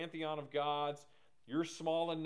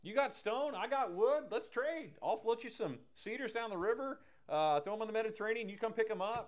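imitating a chummy dude, pitched up high; high-voiced mock dude making a friendly trade; easygoing old buddy; 7 semitones higher than usual. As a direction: pitch it high, like a pal offering a deal. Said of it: "he's the guy that's like".

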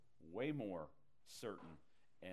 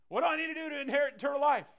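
high, loud, fast hammed-up question; eager rich young ruler; 15 dB louder, much faster than usual. Pastor voicing the rich young ruler asking Jesus, high and fast. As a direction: play the eager young man sure of himself.

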